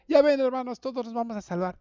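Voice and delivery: hammed-up triumphant misreading; mock misreader. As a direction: play the careless reader who jumps to conclusions. Mocking a careless misreading.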